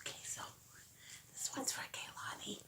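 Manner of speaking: whispered aside. Real, not acted. Mom whispering who the gift is for, hushed. Secret aside during surprise.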